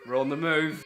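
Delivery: excited repeat. Acted. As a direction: punch it out with glee.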